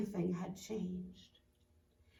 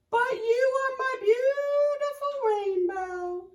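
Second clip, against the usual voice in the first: heartbroken plea; 13 semitones higher, 6 dB louder than usual. Acted. Tadpole pleads with caterpillar, high crying voice. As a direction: cry it like a broken heart.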